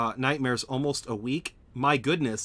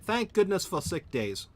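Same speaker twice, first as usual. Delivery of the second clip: higher-pitched attempt at an English accent; bad English accent; 7 semitones higher than usual. Shown as a demonstration. Streamer retrying a line about sick days, bad English accent. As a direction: say it in a badly faked English accent. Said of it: "I am so bad at that".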